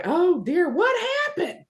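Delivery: high, startled cry of alarm; alarmed nurse. Retold or imitated. Mother retelling the nurse's shock, high and startled.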